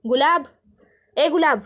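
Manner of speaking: loud call across the house; lady of the house calling out to Gulab, loud. Acted. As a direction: call the servant loudly.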